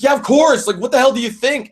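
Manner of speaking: high, exasperated retold scolding; irate retort. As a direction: imitate an angry coworker snapping back. Retold angry coworker scolding him on phone.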